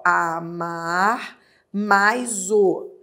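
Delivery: "amar mais o" stretched very slowly; slow demo. Shown as a demonstration. Teacher contrasting pronoun forms for the class, very slow. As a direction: drag out "amar mais o" word by word. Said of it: "Amá-lo é só se fosse".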